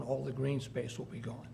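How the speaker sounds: ordinary talk, nothing marked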